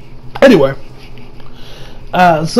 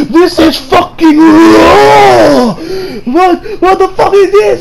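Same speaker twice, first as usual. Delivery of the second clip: high, drawn-out angry chef shout; Gordon Ramsay; 9 semitones higher, slower than usual. Retold or imitated. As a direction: bellow it like an outraged TV chef. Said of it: "his best Gordon Ramsay impression".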